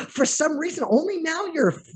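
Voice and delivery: high, bursting embarrassed laughter; laughing. Real, not acted. Host laughing at missing his guest on Zoom. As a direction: laugh it off, caught not noticing him. Surprised Ari was there all along.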